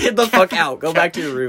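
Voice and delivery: imitating a loud yell to get out; mock yell. Friend imitating shouting a pest out of the room, mock yell.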